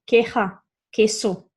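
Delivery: queja and queso starting with a plain K; no W glide in queja, queso; Spanish queja, queso with English K sound. Shown as a demonstration. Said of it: "makes the English K sound".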